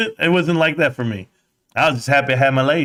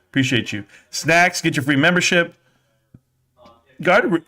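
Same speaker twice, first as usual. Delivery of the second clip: normal talking voice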